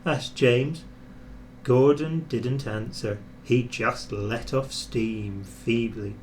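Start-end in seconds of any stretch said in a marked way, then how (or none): none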